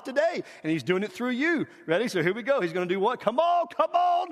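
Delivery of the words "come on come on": high, drawn-out urging; rallying call. Real, not acted. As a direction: rouse the crowd to join in. Rallies congregation to join in.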